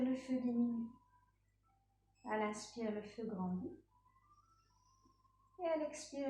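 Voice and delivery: low, subdued relaxation voice with long pauses; guided breathing meditation. Real, not acted. Yoga teacher settling students in a resting pose, calm guided meditation. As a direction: ease them into rest, phrase by phrase.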